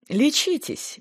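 high, gracious condescension; condescending permission. A narrator's reading. Secretary graciously permitting Rina to rest, high and condescending. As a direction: grant it from above, pitched high. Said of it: "милостиво разрешила Виолетта".

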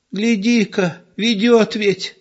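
put-on high, slow flustered old woman's cry; alarmed old woman. A narrator's reading. Old wife alarmed at the window, high flustered cry. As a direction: gasp it out like a startled granny. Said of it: "всполошилась она".

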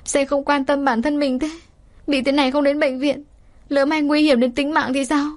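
a touch of crying in a scolding plea; sobbing. Acted. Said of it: "Nước mắt chảy dài nức nở nói". (giọng nức nở nhẹ, cao)

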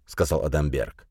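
plain reading voice

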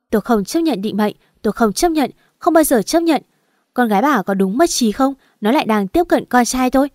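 mother refuses, voice building to a yell; shouting refusal. Acted. Furious mother shouting down the phone at the other mother, building to a yell. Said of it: "Hét lên".

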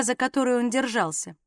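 plain reading voice

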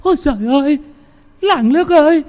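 put-on high, shrill panicked wail of an old woman; terrified shriek. A narrator's reading. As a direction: scream it out in terror at the returning dead. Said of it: "Âm thanh của bà Quỳnh hét lên thật to". (tiếng thét hoảng loạn của bà Quỳnh)

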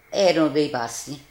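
ordinary talk, nothing marked

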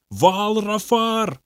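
slow, high, eerie demon cry; demon calling out. A narrator's reading. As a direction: stretch the name out in a high alien call. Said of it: "кричал Бобби". (медленный, высокий зов демона)

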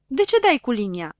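loud, indignant cry; shouting. A narrator's reading. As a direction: protest loudly at being hit. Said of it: "începu ea să strige". (strigăt indignat)

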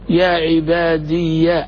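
يا عبادي drawn out very slowly, final ي said as ya; slow demo, moving ya. Shown as a demonstration. Teacher showing the moved ya, slow demonstration. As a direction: stretch يا عبادي with an open ya. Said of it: "تحركت الياء".

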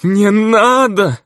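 high, slow, desperate wail; drawn-out terrified cry. A narrator's reading. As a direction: stretch it out in horror. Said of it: "выдохнул он, с ужасом". (протяжный крик ужаса)

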